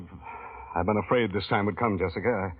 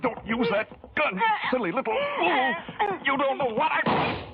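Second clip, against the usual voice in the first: high frantic pleading at gunpoint; terrified shrill begging; panicked plea. Acted. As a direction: beg her to drop the gun, voice shooting up. Begs at gunpoint.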